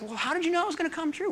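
high, quick incredulous question; mock astonishment. Counselled young men return to the pastor, astonished. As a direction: imitate someone baffled after the fact.

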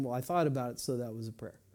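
low, soft, dismissive throwaway; offhand excuse. Retold or imitated. Believer passing off a thought as prayer, offhand and soft. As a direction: mimic a half-hearted self-justification, trailing down. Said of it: "we're just thinking about it".

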